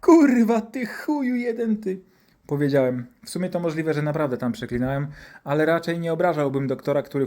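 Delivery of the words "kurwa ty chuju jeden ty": high, drawn-out swearing wail; loud burst at the start, high throughout; outraged child cursing. Acted. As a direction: curse the doctor in hammed-up agony. Child curses the doctor.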